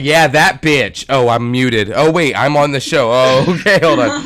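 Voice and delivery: loud, laughing yell at the screen. Retold or imitated. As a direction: shout at the TV, laughing. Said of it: "I was screaming at the T V".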